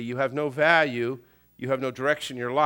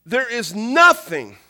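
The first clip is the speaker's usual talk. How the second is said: loud, high, emphatic pulpit voice; impassioned sermon, punching key words; forceful preaching; 8 semitones higher, 10 dB louder, slower than usual. Real, not acted. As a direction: drive the point home to the congregation. Preaches the main point forcefully.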